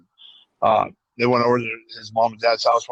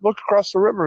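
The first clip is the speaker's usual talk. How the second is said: put-on higher, eager kid voice; imitating a kid; 8 semitones higher than usual. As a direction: play a pleading child, pitched up. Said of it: "the kid's like".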